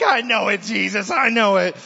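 loud, high-pitched pleading; hammed-up penitent wail; mock contrite cry. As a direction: cry out as if caught guilty. Acted sinners cowering at rebuke.

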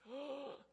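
sharp gasp coming up out of water; surfacing gasp for breath; gasp for air. Shown as a demonstration. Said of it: "what's the first thing you do when you go up out of the water".

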